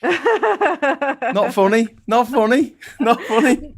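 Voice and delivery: not funny said high while laughing; mock indignation, laughing; laughing protest. Real, not acted. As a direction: fake outrage through laughter.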